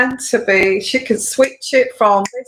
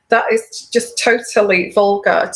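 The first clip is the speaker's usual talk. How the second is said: ordinary talk, nothing marked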